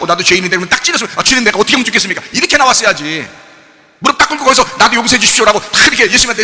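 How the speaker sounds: loud, high, urgent exhortation; impassioned pulpit reproach; full-force preaching. Acted. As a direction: press the congregation hard, barely letting up.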